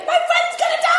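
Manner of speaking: very high, loud shriek of alarm; panicked shout. Retold or imitated. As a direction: scream it like a terrified friend. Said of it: "She's like".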